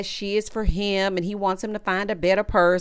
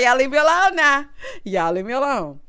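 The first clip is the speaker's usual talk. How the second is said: normal talking voice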